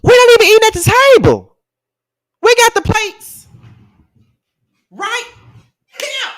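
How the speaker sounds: high, fast exasperated outburst; worked-up rant. Real, not acted. Ranting at the millionaire host, high and fast. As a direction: throw it up high in disbelief. Ranting about eating off laps.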